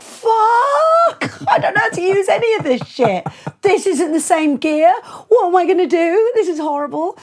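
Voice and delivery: high, loud panicky outburst; a half-laughing wail of alarm at unfamiliar gear; sudden stage fright at the console; retold panic.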